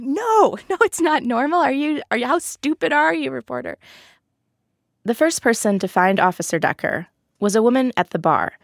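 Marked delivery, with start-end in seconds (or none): 0.0-3.8 high, laughing, scornful mock answer; mocking disbelief. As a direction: scoff at the dumb question, laughing. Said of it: "they're like".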